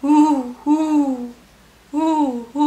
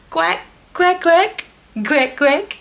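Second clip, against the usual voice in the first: loud, high playful quacking; cheerful duck impression for toddlers; duck quacks; 6 semitones higher, 7 dB louder than usual. Shown as a demonstration. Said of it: "Can you quack like a duck?".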